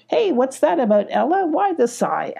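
lightly acted curious concern; inquiring. A narrator's reading. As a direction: coax an answer with lifted, curious voice. Sparkle asks Ella about sighing.

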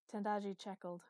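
plain reading voice

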